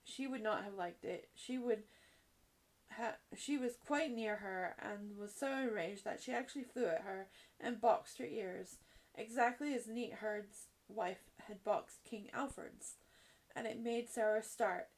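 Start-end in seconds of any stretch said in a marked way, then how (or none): none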